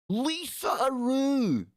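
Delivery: stretched, delighted call of a name; drawn-out greeting. Real, not acted. Host greets viewer Lisa in chat, drawn-out high call. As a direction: sing her name out long and high.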